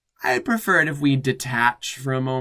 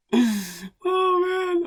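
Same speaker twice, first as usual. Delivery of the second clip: overwhelmed cry; 5 dB louder than usual. Real, not acted. Overcome reaction, cry heard.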